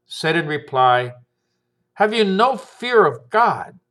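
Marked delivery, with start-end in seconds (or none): 1.9-3.8 higher, indignant rebuke; rebuking. A narrator's reading. Second criminal rebuking his companion, high and indignant. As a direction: cut him off with a sharp reproach. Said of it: "rebuked him".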